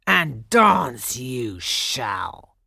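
very slow, loud growled order; menacing growl. A narrator's reading. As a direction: draw out the threat with force. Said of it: "he growled".

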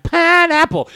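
put-on high-pitched, silly kid voice; imitated laughing kid. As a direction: mimic a kid squealing a joke word. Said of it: "they're laughing. He's like".

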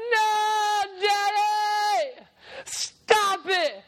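put-on high, loud little girl crying out; pleading girl shout. Daughter bursting in, shouting to her dad to stop. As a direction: beg your father to stop at the top of your voice.

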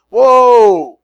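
loud high "Whoa!" of shock; stunned disciples. Acted. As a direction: gasp out a big startled whoa. Disciples stunned by Jesus's claim.

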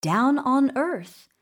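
ordinary delivery, nothing marked

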